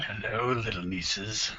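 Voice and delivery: slow, soft, creepy greeting. Pretend. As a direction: lean in like a creep. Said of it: "That's creepy".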